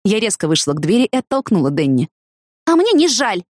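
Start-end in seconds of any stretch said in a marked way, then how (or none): 2.7-3.4 high, mildly spiteful retort; a touch of hissing defiance; hissed. A narrator's reading. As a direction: answer back sharply, pitched up. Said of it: "прошипела я".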